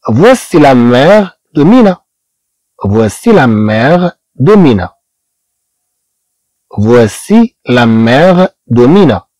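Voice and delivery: each word of "voici le père de René" stretched out slowly; slow French model sentence. Shown as a demonstration. Teacher reading the French example slowly to students. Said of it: "الجملة الثانية".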